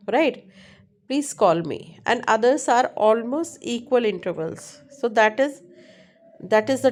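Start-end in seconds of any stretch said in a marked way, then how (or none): none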